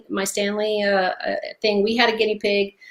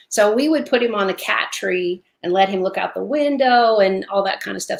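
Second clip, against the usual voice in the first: normal talking voice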